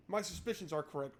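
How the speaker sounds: ordinary talk, nothing marked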